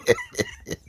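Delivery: high-pitched real laugh. Real, not acted. Host laughs at guest's reply.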